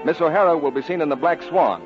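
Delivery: usual speaking voice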